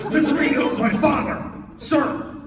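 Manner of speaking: ordinary delivery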